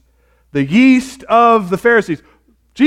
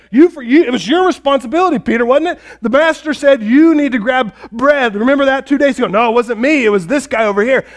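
high-pitched, quick accusing squabble; hammed-up argument, raised pitch, tumbling along; mock bickering; 7 semitones higher, 3 dB louder than usual. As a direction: act out friends passing the blame. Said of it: "And they start arguing about that".

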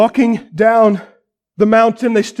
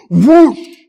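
whoosh sound effect; 4 semitones higher, 7 dB louder than usual. Retold or imitated. Cloud sweeping in.

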